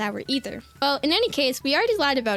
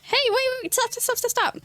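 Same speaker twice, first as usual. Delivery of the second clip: high, urgent stop calls; mock yelling stop; 8 semitones higher than usual. [name] stopping the friend from blabbing, high pleading. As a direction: cut him off in a panic. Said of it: "Why are you yelling".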